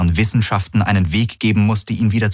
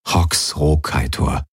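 plain reading voice

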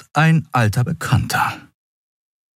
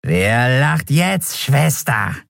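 slow, higher, sneering creature voice; gloating skeleton; 5 semitones higher, much slower than usual. Acted. The skeleton mocks Endriel, slow gloating. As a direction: savour every word of the taunt.

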